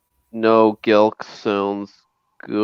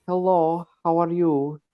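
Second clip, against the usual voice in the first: put-on girl's voice; 8 semitones higher, 3 dB quieter than usual. Host attempting Laura's accent, high girlish voice. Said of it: "hard for me to sound like a girl".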